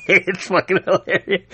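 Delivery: words squeezed out through real laughter; laughing. Real, not acted. Cracking up while telling viewers about the comic.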